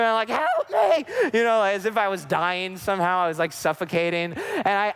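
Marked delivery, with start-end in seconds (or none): 0.3-1.1 high yelled cry for help; mock-panicked high shout; retold child scream. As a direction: scream it like a panicked six-year-old. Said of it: "so I'm screaming".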